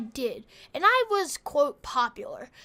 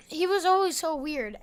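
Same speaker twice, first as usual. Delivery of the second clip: normal talking voice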